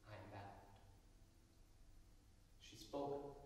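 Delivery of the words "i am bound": much softer, lower tearful reply; a quiet tearful answer; weeping softly. A narrator's reading. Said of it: "weeping, answered".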